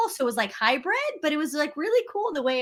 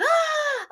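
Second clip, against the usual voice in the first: excited gasp. Retold or imitated. Excited reaction to invitation.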